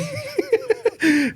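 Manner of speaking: squeaky burst of laughter; high-pitched laugh. Real, not acted. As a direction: break into a high giggle. Cracks up reading review.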